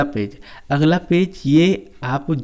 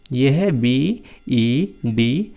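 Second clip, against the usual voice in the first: very slow letter by letter spelling; much slower than usual. Shown as a demonstration. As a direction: drag each letter so kids follow. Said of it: "teacher spells word slowly". (धीरे-धीरे spelling बोलना)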